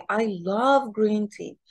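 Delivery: "love" emphasized above the other words; stress on LOVE. Shown as a demonstration. Teacher repeating the woman's line, stressed "love". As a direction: make "love" the most important word. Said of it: "she stressed the word very much".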